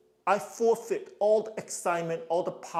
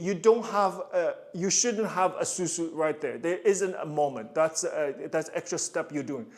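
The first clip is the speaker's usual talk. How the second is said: ordinary delivery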